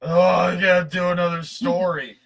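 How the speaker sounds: loud, high tipsy blurt; drunk self. Retold or imitated. Host imitating his tipsy self, loud and high. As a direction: blurt it out like a drunk version of yourself. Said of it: "and I'm just like".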